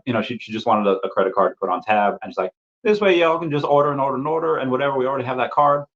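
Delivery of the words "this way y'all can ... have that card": high, quick Southern Belle pitch; put-on Southern Belle saleswoman; Southern Belle accent. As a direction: imitate a Southern Belle coaxing a customer. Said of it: "Southern Belle accent".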